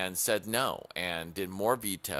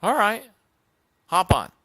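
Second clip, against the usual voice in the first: high, loud, cheerful frog; a naive frog giving in, loud and high; 8 semitones higher, 9 dB louder than usual. Acted. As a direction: chirp out the frog's agreement. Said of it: "Frog goes like".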